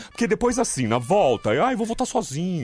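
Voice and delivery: high, pleading whine about driving home alone; mock whine. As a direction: play the lonely friend, begging. Coaxing friend to join trip.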